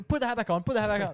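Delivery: retold flustered instruction; panicky urging. Retold panicked order to mum.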